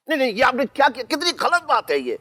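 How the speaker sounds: high-pitched, upset scolding; indignant protest. Acted. Man scolding her for what she just did, indignant.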